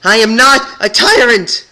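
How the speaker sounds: very high, loud scream. Acted. Twilight denying she is a tyrant, scream. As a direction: scream the denial. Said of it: "came out as a scream".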